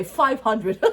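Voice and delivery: laughing. Retold or imitated. Wife playing along with the price guessing game, laughing. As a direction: say it through a laugh.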